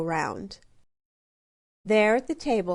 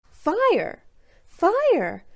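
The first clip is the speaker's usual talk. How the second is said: excited shout; 9 semitones higher, 5 dB louder than usual. A narrator's reading. Cub finds his new name.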